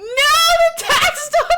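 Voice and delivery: high, loud shriek of disbelief; shocked shout. Real, not acted. Viewer reacting to the tax dodger twist, shocked shriek.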